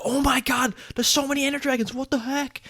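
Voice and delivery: high-pitched panicky player imitation; mock panic. Players react to so many Ender Dragons, mock panic. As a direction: squeal it like a hammed-up panicking gamer.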